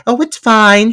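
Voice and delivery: high, bright-voiced mock reassurance; mock breezy reassurance. Imitating the actress coaxing the horse, high and airy. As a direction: pitch it way up, airy and dismissive. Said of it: "she's like".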